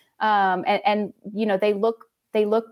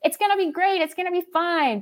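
mock naive optimism; 8 semitones higher than usual. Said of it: "I'm not ready to say, like".

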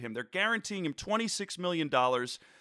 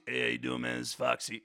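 lower, softer laid-back dude voice as Foxy; Foxy the fact checker; 6 semitones lower, 3 dB quieter, faster than usual. Pretend. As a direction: sidekick Foxy saying hi, lower and quieter. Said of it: "I wanna introduce you to Foxy".

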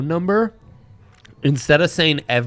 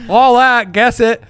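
excited; 8 semitones higher, 5 dB louder than usual. Acted. Hyped ad read opener.

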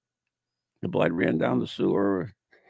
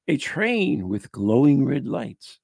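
slow, drawn-out storytelling; ghost story read aloud; 2 semitones higher, 5 dB louder, slower than usual. Acted. Host opening the tumbling train legend, slow narration. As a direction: draw out the eerie detail.

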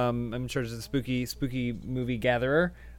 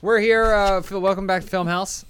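loud greeting; 5 semitones higher, 4 dB louder than usual. Real, not acted. Show greeting, called out.

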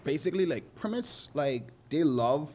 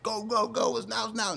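much higher rushed orders; frantic drill sergeant; 9 semitones higher than usual. Retold or imitated. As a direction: panic the client into casting. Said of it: "like a drill sergeant".

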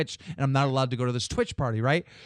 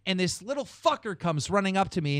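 normal talking voice

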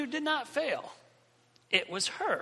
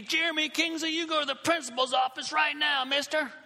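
higher-pitched scolding shout, imitated; yelling teacher; 6 semitones higher than usual. Teacher sending pupil to principal's office, yelling. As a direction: order the pupil out in a raised, high voice. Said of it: "the teacher starts yelling at me".

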